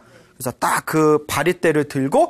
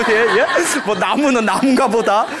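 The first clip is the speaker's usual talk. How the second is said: careless mock shrug; 6 semitones higher, 4 dB louder than usual. Mocking careless listeners' reaction.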